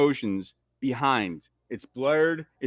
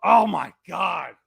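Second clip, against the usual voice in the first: slow, higher, stunned excitement; awestruck exclaiming; 4 semitones higher, 3 dB louder, slower than usual. Real, not acted. Fan overwhelmed by the greatest scene, exclaiming in awe. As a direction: draw out each exclamation in amazement.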